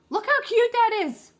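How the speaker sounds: high squealy gush over something cute; delighted high-pitched cooing. Real, not acted. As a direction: coo at it like it's adorable. Gushing over cute craft carrot.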